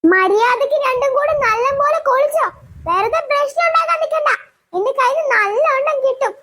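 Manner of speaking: a mother laying down the law; mother warning both daughters to behave; scolding mother. Acted.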